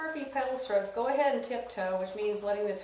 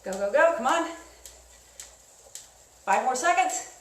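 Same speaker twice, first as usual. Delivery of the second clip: coaching shout; 3 semitones higher, 6 dB louder than usual. Real, not acted. Spin instructor pushing sprint.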